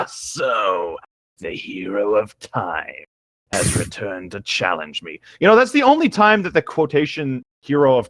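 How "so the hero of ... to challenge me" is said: slow, low mocking villain; mild sneer. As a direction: sneer each word slowly. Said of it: "The sneering voice called again".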